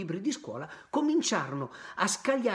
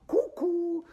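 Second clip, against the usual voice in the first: high teasing "Cucù"; mocking cuckoo; 14 semitones higher, 4 dB louder than usual. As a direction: taunt with a sing-song "Cucù". Pinocchio mocking.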